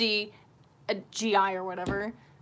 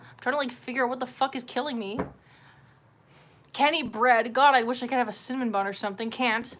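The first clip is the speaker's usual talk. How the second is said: normal talking voice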